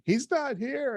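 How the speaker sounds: high, lightly acted crying wail; mock crying. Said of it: "she calls me crying".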